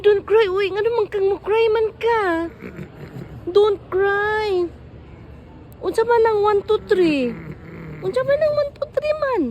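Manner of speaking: high, loud coaxing; urgent comforting. Real, not acted. Caregiver to crying Kuya, telling him not to cry, urgent comforting.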